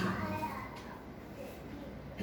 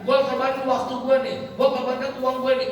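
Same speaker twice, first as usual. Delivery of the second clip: higher-pitched, impassioned sermon delivery; fervent preaching; 6 semitones higher than usual. Acted. Preacher urging couples to serve with love, fervent. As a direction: preach it with rising urgency.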